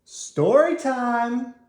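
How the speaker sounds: loud, high, slow jolly call to the class. Acted. Teacher calling the noisy class to the book corner, jolly and loud. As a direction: sing it out to gather the children. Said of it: "a loud, jolly voice called out".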